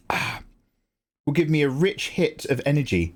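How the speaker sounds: lightly hammed commercial read; a hint of infomercial host; mock ad pitch. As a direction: sell the tea like a TV advert. Parody ad pitch for tea.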